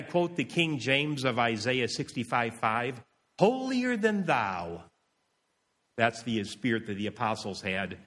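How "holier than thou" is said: drawn-out, high haughty proclamation. Acted. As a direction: proclaim it slowly, pitched up, with haughty superiority.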